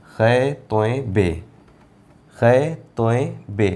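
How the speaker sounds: slow, separated letter names said twice; slow spelling. Shown as a demonstration. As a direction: spell each letter slowly for the class. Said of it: "letters spelt out slowly, repeated". (अक्षर धीरे-धीरे दोहराकर बोलना)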